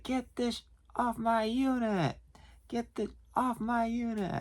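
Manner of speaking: slow, high-pitched mock yell; imitated yelling. Customer demanding his storage lock removed, mock yelling. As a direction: stretch it out like a held-back holler. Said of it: "I heard him yelling".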